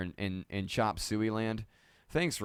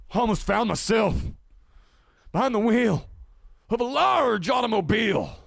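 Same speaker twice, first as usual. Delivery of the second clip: higher and louder, building to a shout; giddy relief turning into a shout; excited; 10 semitones higher, 7 dB louder than usual. Real, not acted. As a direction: ramp up the thrill line by line. Stream comes back, building excitement.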